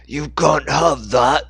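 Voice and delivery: slow, loud furious warning; angrily. Acted. Cult leader angrily blocks Dixon from the tablet with a sword. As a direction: threaten him, seething. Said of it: "spoke to Dixon angrily".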